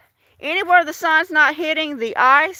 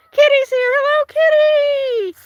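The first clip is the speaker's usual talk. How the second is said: delighted greeting; 12 semitones higher, 3 dB louder, faster than usual. Real, not acted. Spotting a viewer arrive.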